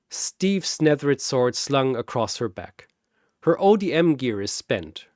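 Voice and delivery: usual speaking voice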